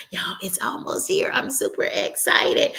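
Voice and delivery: hushed, whispery excitement; excited whisper. Real, not acted. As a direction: whisper it like thrilling news. Confiding birthday excitement, whispered.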